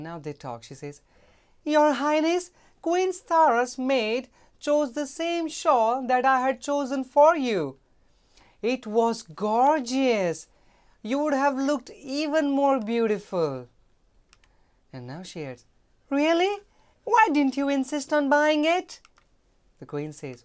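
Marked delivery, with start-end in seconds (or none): 1.6-13.7 high, loud whiny maid; complaining maid. Acted. Maid complaining to Queen Rupa about the shawl, high and loud. 16.1-19.0 high, loud indignant queen. Acted. Queen demanding why the maid didn't insist, high and loud.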